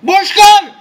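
screaming the name, very high and loud; frantic shout. Real, not acted. Gamer under fire screams for teammate Bushkar. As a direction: yell across the map for help. Calling teammate during a fight.

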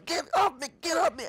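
very high, strained mock yelp; panicked struggle. Satanist friend being set on fire, acted panicked struggle. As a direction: cry out as if fighting someone off.

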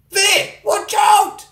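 urgent warning cry; warning shout. Real, not acted. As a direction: yell a warning. Warning a friend.

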